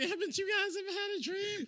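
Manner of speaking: high, soft falsetto quote; high mock voice. Host mimicking movie characters who burst into song, high mock voice. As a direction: put on a high, dreamy little voice.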